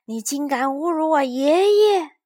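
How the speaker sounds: high, loud outraged yell; furious shout. A narrator's reading. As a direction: scream at her for insulting grandpa. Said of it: "绅士气得大叫".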